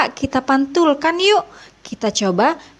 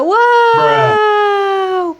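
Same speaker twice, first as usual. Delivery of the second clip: amazed wow; 7 semitones higher, 3 dB louder than usual. Real, not acted. Basket magically emptied, amazed.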